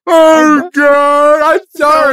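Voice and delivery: high, loud, hammed-up wailing; emotional outburst. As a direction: wail the apology in mock tears. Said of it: "highly emotional outburst".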